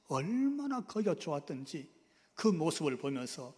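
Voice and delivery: normal talking voice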